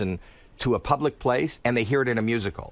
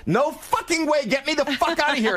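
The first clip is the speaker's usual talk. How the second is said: high, frantic panicked shouts; panicked yelling. Retold or imitated. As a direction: yell in terror to get out. Guy panics at dancing gang.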